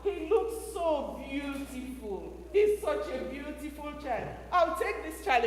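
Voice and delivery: put-on Pharaoh's daughter finding the baby, soft admiring voice; admiring princess. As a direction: coo over the beautiful baby. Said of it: "Pharaoh's daughter said".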